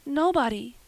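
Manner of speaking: put-on high-pitched girlish admission; confessing. A narrator's reading. Anne answering Marilla's scolding, confessing. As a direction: own up in a girl's voice. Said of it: "confessed Anne".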